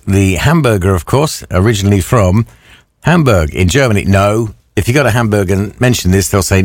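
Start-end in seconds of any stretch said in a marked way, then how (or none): none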